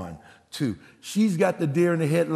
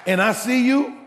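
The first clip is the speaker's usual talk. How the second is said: loud, raised pitch mock confrontation; mock demand; 7 semitones higher, 3 dB louder than usual. Preacher to congregation, loud mock demand for money. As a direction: call him out for five dollars, loudly.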